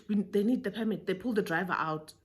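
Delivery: usual speaking voice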